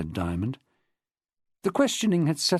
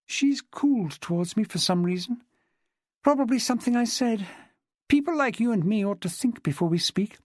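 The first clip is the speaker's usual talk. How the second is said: higher-pitched woman's dialogue voice; lightly voiced chatty woman witness; 8 semitones higher than usual. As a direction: lightly play a woman recounting her club life. Narrator voices Jessica in dialogue.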